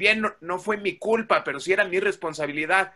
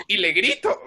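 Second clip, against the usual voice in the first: mock shout. Shown as a demonstration. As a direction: act out the yell on "grito" in jest. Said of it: "tú dime a quién le grito". ("y le grito" como grito en broma, mucho más agudo)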